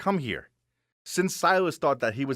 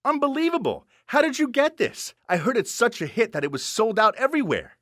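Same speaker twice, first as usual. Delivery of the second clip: high, put-on amazement; exaggerated wonder, pitched way up; acted surprise; 9 semitones higher, 3 dB louder than usual. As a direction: play astonished for the skit. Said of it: "Carl acted surprised".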